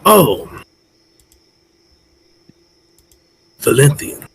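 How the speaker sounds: a touch of surprise; mock surprised recognition. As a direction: recognize an old friend. Kroll greets old acquaintance.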